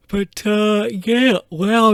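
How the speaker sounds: talking through a yawn; stretched, yawning words. Real, not acted. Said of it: "I'm yawning".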